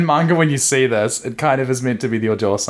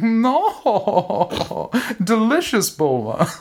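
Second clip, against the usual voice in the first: high, haughty queenly lilt with an affected laugh; Whis; 11 semitones higher, much slower than usual. Retold or imitated. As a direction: hoot it grandly like a true queen. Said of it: "Whis who does talk like that".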